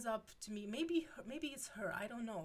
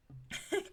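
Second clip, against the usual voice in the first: sudden high laugh; a quick squeal of a laugh; high burst of laughter; 12 semitones higher, 6 dB louder than usual. Real, not acted.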